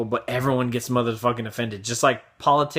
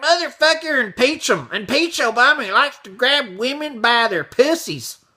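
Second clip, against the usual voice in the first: mock outraged shout; 12 semitones higher, 4 dB louder than usual. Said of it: "you'd be like".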